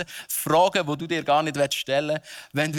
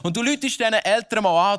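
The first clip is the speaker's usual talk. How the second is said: usual speaking voice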